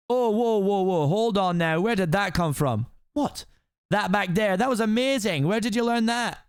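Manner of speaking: astonished ogre. Acted. Ogre questioning the princess's fighting, astonished. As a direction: stop someone short, amazed, as the ogre.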